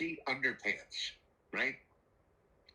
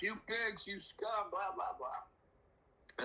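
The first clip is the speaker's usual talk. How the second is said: higher, sneering tirade fading into slow mumbling; mock screaming insults; 7 semitones higher, much slower than usual. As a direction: spit insults at the cops, then trail off. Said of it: "screaming at the cops".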